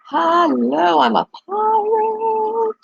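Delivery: high, slow goofy pirate. Pretend. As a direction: drag it out like a silly pirate. Playing with face filters.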